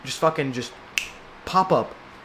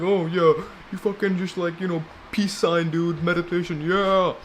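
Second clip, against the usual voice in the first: lightly voiced stoner bro, a bit higher; mock chill dude; 4 semitones higher than usual. Mocking a chill meditation bro, lightly raised voice. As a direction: drawl it like a lazy hippie.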